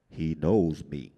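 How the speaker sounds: a hint of the big man's calm rumble; low giant voice.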